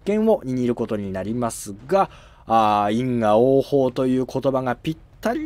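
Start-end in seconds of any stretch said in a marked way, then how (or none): none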